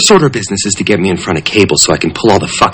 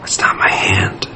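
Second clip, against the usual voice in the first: whisper; 2 semitones lower, 7 dB quieter, slower than usual. Acted. Whispered reply.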